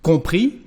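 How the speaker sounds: compris ending on the i with no S; French compris without the S; silent final S. Shown as a demonstration. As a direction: stop compris on the vowel. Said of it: "we don't pronounce the final S".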